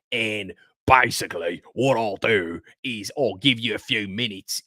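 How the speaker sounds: a playful mock of Ange's Australian coaching talk; imitating Ange Postecoglou's Australian accent. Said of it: "a video of you doing Ange Postecoglou's accent".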